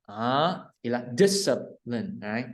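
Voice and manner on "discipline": "discipline" slowed, weight on the first syllable, dis; stress on DIS. Shown as a demonstration. Teacher tells learner to stress the first sound, stress on DIS. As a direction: lean on DIS in "discipline", then fall away. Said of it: "nhấn âm, âm dis".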